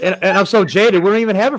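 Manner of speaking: high, loud, laughing self-mockery. Real, not acted. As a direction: laugh it out, joking about yourself. Laughs while joking about himself.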